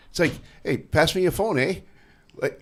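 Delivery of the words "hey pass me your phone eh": lightly mimicked Canadian, raised pitch; imitated Canadian accent with tag "eh". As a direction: put on a mock Canadian lilt. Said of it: "he had a Canadian accent".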